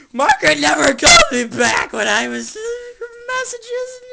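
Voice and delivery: high, loud put-on voice sliding into a crying shout; hysterical whiny rant. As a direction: wail it like a man falling apart. Guy voiced whining about his life.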